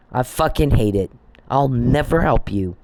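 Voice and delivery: ordinary talk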